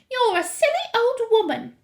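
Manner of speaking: very high, loud indignation; shrieky, affronted wife; outraged mother. A narrator's reading. Mrs. Jenkins complains of the grandmother, high and outraged.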